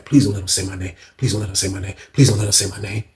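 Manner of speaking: low, soft plea under the breath; hushed repeated begging, imitated; low pleading. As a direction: pray quietly to stay out of it. Praying to himself not to be named.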